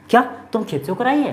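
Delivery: quick, high-pitched startled question. Acted. Halku asks Munni about the field, startled. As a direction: voice jumps up in acted disbelief.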